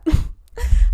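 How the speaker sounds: big wheezy laugh; cackling at her own joke; loud burst of laughter. Real, not acted.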